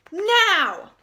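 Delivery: high, loud, drawn-out command; shouted order. A narrator's reading. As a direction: give the signal at full voice. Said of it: "Rebel gave the order".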